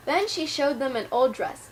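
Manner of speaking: ordinary delivery, nothing marked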